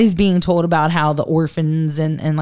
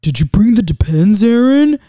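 Zaddy; 4 dB louder than usual. Retold or imitated. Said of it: "Zaddy goes".

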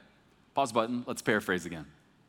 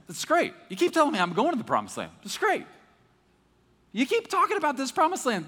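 exasperated Moses; 7 semitones higher than usual. Retold or imitated. Preacher playing Moses, tired of promises, exasperated. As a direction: complain to God, pitch climbing on every great.

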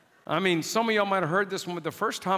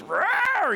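high, loud possessed roar; a sudden beastly roar; demonic roar; 13 semitones higher, 3 dB louder than usual. Retold or imitated. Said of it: "she was".

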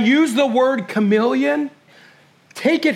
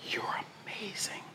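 intimate whisper; barely-there, tender whisper; whispered reassurance; 21 dB quieter than usual. Real, not acted. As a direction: breathe it out as a secret affirmation. Repeats the affirmation in a whisper.

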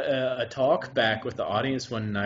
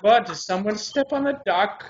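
higher-pitched mock-innocent German accent; 9 semitones higher than usual. Old man covering for his fart machine, German accent. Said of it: "it's such a great accent".

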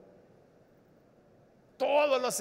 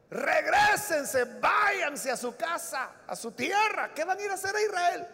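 higher, pleading mother-in-law; urgent plea; 6 semitones higher than usual. Acted. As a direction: send them away, insisting. Naomi urging daughters-in-law home.

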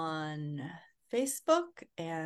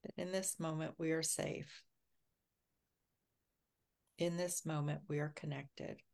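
guided meditation. Acted. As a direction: ease everyone into calm and safety. Leads a grounding meditation.

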